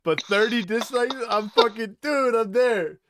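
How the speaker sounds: loud, high disbelieving laugh-talk; laughing outrage at his own ratio; excited. Real, not acted. Laughing disbelief over dislikes.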